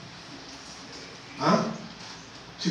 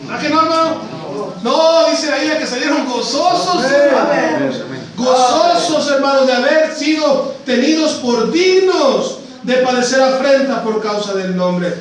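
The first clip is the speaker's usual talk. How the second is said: louder, jubilant pulpit proclamation; preaching at full force; 5 semitones higher, 5 dB louder than usual. Acted. Preacher telling the congregation the apostles rejoiced, full preaching voice. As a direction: proclaim it with triumph.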